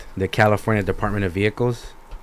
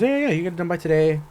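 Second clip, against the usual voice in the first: higher and fast, an eager clerk brushing the problem aside; imitating the clerk reassuring him, higher and fast; mock clerk voice; 8 semitones higher, faster than usual. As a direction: rattle it off like a clerk hurrying him along. Clerk voiced, quick and high.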